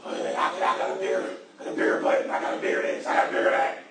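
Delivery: whispery mock Trump boast, voice unlike his own; Trump impression. Preacher mocking Trump to the church, whispery boast. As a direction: pretend to be Trump bragging. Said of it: "What he would say?".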